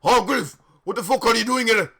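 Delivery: loud, high, outraged yell; hammed-up shout. A narrator's reading. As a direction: bellow it fast in mock outrage. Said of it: "he shouted".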